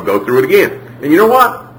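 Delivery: ordinary talk, nothing marked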